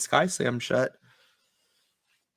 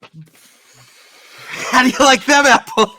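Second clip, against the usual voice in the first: loud, slow Boston taunt; Boston movie quote; 10 semitones higher, 11 dB louder, much slower than usual. Retold or imitated. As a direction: belt it like the bar scene. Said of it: "Good Will Hunting quote".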